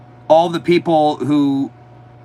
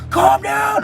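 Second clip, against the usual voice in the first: much higher yelled calm down; mock cop yell. Retelling riot police yelling at him, high mock shout. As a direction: bark it like a riot cop on top of you.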